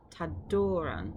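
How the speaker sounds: Tadoran said slowly with a plain, untrilled R; without rolled R. Shown as a demonstration. Teacher showing the untrilled R, slow careful pronunciation. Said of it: "without the b on the R".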